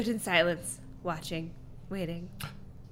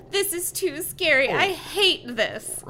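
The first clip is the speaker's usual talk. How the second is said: moaning; 7 semitones higher, 6 dB louder, slower than usual. Acted. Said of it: "April moaned".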